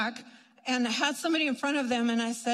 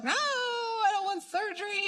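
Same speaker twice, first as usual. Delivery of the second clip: whiny protest; 9 semitones higher than usual. Retold or imitated. Refusing surgery.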